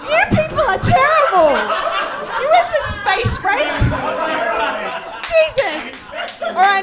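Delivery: high-pitched laughing disbelief. Real, not acted. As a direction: laugh through the words, scandalised. Host reacts laughing to audience.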